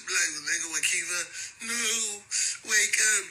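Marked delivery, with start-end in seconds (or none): 1.7-3.2 high mock sobbing plea over a dead friend; hammed-up wail, pitched high. Mocking a homie who cries for [name], high mock wail.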